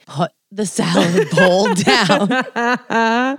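crying wife ordering husband to drop his salad; mock desperate order. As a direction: drag out each word at him.

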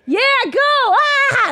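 mock child's excited scream; kid screaming. Imitating son Ellis screaming while playing, high kid yell. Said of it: "I hear Ellis screaming like".

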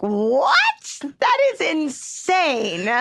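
drawn-out, incredulous reaction; slow, rising-pitched astonishment; retold disbelief. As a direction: stretch the words slowly in disbelief. Retold disbelief at fiance's answer.

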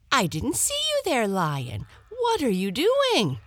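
high, fast little hare voice; a small animal chattering in panic; frightened hare. Acted. Hare caught by the lion.

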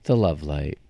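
very low, sultry radio host. Acted. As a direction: purr the show name. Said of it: "low, sultry voice".

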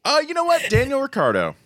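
high, quick cover-up answer; sudden pick. Real, not acted. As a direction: put on the spot and picking a name fast. Blurting a pick after being teased.

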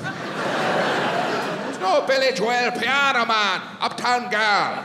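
high, drawn-out mock Irish voice; Irish accent. Sound guy lists songs to the American, exasperated Irish accent. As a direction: spell it out slowly in an Irish accent.

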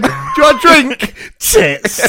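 put-on high, loud parent then deadpan kid; mock parent and kid. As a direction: play the family dinner joke.

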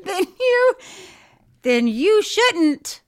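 high, loud, drawn-out mock reprimand; mock scolding. Host plays along with teasing about her scolding, mock-stern. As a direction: ham up the telling-off, stretching it slowly. Joins the joke, hamming up own scolding.

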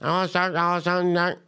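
put-on high, fast, slurred Memphis accent; thick Memphis accent. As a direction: mimic a waitress's nearly unintelligible Memphis accent. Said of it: "she had a very thick accent".

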